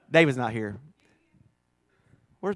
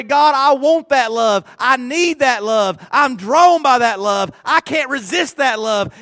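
fervent prayer; 5 semitones higher, 6 dB louder than usual. Retold or imitated. Voicing a believer's prayer.

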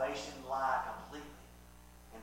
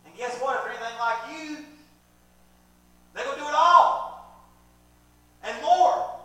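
full-force preaching; 11 semitones higher, 16 dB louder than usual. Real, not acted. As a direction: belt it out to the congregation.

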